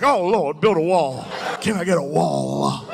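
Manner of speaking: dad caught by the Holy Spirit, preaching; church preaching. Retold or imitated. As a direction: shout it like a revival sermon. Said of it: "he gets hit with the Holy Spirit".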